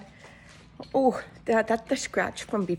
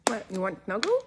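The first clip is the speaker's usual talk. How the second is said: talking to a dog; 3 semitones higher than usual. Real, not acted. Cooing to her pet bird.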